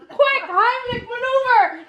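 high, joking mock-panic call; mock alarm. Host jokingly calls for help as grandma chokes. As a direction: pretend to raise the alarm, laughing.

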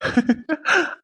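high, amused chuckle while teasing; teasing laugh. Real, not acted. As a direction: laugh at her shy reaction. (மனைவியை கிண்டல் செய்து சிரிக்கும் கணவன்)

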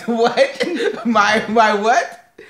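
loud, cracking up through the question; amused, incredulous outburst; laughing disbelief. Real, not acted. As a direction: ask it through helpless laughter. Cracks up at partner's odd word.

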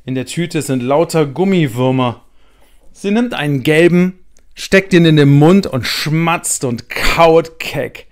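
usual speaking voice

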